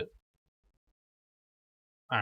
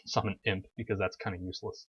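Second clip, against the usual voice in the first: ordinary delivery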